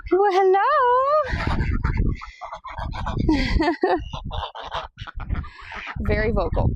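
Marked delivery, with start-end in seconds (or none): 0.1-0.6 cheery greeting. Real, not acted. Greeting the noisy birds. 0.6-6.0 high delighted laugh. Real, not acted. Laughs at the birds.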